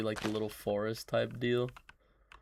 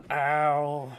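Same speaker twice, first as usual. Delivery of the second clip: loud yelp of pain; 4 semitones higher, 8 dB louder than usual. Real, not acted. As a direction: yelp at a sudden knee pain. Hurt knee.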